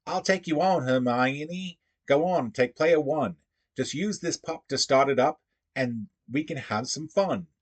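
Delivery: high, put-on British voice; British accent. Shown as a demonstration. Puzzle solver voicing a reply to Hermione, British accent. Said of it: "that is my British accent".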